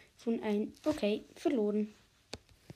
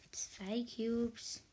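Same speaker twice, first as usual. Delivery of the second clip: whispering; 7 dB quieter than usual. Real, not acted. Quiet aside while playing.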